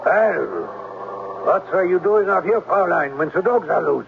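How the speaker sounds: put-on gruff German-accented caretaker, suspicious. German-accented Klemper catches her outside with the dogs loose. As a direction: lean into a heavy German accent, challenging her.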